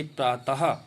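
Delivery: प्रातः ending in a clear breathed "ha"; visarga as ह. Shown as a demonstration. Teacher showing how visarga sounds, प्रातः with a final ha. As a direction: say प्रातः as "praa-ta-ha". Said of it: "उच्चारण ह की तरह होता है".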